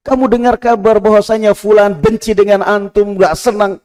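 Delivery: high, loud exhortation; preaching at full force. Acted. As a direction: urge the faithful not to complain. Sermon over music.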